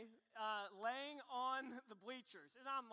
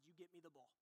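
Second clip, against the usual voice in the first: cocky order; 5 semitones lower, 14 dB quieter, faster than usual. Retold or imitated. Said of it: "I said".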